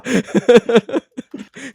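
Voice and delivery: high, loud rolling laugh; loud laughter. Real, not acted. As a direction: keep cracking up loudly. Still laughing at the story.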